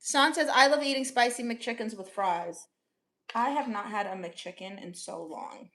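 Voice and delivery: ordinary talk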